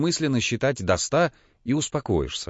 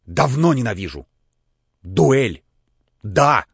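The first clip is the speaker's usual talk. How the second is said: тяжело дыша, злобно; 4 dB louder, slower than usual. A narrator's reading. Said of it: "тяжело дыша".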